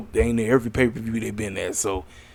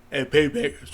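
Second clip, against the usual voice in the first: yawning; 2 semitones higher, 5 dB quieter, slower than usual. Real, not acted. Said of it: "I do not like to yawn".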